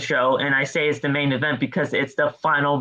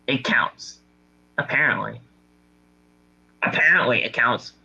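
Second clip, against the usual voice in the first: ordinary talk, nothing marked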